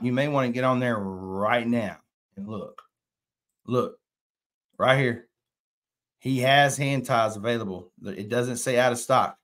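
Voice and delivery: normal talking voice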